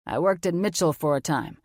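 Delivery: a mature man, calm and sure of himself; authoritative confident man. Acted. Logan voiced as man.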